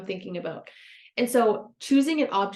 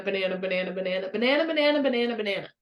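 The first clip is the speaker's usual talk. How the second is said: rapid repetition; 3 semitones lower, faster than usual. Shown as a demonstration. Showing viewers how a word turns to jumble, rapid chant. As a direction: repeat banana till it loses meaning. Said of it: "say banana fifty times".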